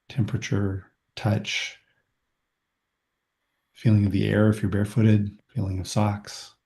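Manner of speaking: walk them through their toes, unhurried; calm body scan meditation voice; guided body scan. Acted.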